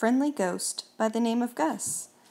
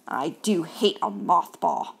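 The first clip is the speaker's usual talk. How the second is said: grumpy mouse; 4 semitones lower than usual. Acted.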